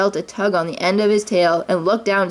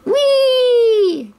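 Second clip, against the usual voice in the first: high, loud whee; gleeful cry; 5 dB louder than usual. A narrator's reading. Roo jumping down from the tree squealing with glee. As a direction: squeal with delight on the jump. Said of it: "cried Roo".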